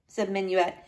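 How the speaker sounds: ordinary delivery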